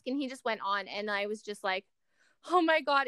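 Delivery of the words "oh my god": amazed gasp. Retold or imitated. Retold amazement at dad.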